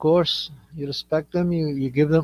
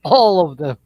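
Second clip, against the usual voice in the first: ordinary talk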